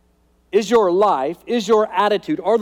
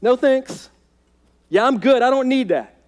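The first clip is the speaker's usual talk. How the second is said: dismissive outsider; 7 semitones higher, 3 dB louder than usual. Retold or imitated. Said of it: "the world looks at that and goes".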